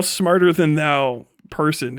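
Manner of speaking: ordinary talk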